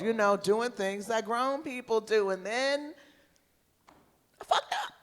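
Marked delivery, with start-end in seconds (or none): none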